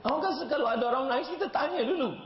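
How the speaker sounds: fervent, high preaching call; forceful preaching. Acted. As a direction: drive the moral home. Preacher driving home the lesson.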